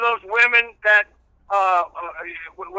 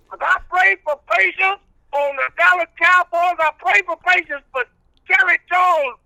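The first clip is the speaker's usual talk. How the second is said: country preacher character; 8 semitones higher than usual. Acted. Old reverend preaching patience to the radio host, in full preacher voice.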